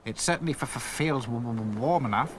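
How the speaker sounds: stammering. A narrator's reading. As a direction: stutter on f and w. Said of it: "stammered Hi-Fi".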